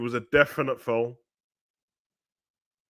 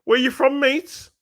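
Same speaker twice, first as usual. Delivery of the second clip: high, loud mock cockney thug asking where you're from; cockney hooligan; 13 semitones higher, 5 dB louder, slower than usual. Retelling a football factory scene, mock cockney thug voice. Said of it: "on a football factory thing".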